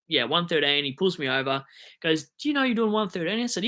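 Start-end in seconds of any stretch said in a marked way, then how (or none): 2.4-3.4 lightly imitated officer, pitched up; higher-pitched retold police question; questioning cop. Cop pulling over a speeding driver, questioning.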